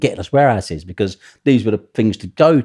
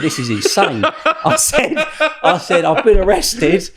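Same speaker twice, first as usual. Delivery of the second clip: high, laughing as he tells it; can barely get it out for laughing; laughing through it; 11 semitones higher, 4 dB louder, slower than usual. Real, not acted. Telling the police cell story, cracking up laughing.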